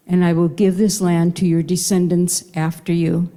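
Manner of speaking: ordinary talk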